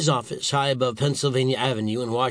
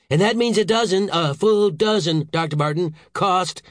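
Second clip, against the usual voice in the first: high, emphatic exasperation; irate boss building his case; angry growl; 6 semitones higher, 4 dB louder than usual. A narrator's reading. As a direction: hammer the figure home. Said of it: "growled Saint George".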